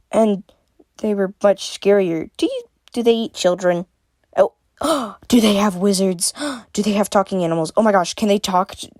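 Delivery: excited, gasping, tumbling questions; eager, rushed curiosity; exploding with questions. A narrator's reading. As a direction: fire off questions in breathless excitement. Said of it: "She exploded with questions again".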